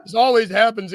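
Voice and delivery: laughing through the same complaint; laughing repeat. Pretend. To his cohost again, laughing as he repeats the complaint. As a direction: let the joke crack you up.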